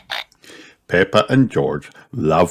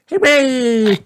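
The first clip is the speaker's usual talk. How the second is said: put-on high, drawn-out child's cheer; 3 dB louder than usual. A narrator's reading. Rabbit children applauding music, high cheer. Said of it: "cheer Richard and Rebecca".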